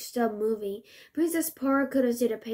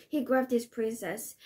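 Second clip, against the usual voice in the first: usual speaking voice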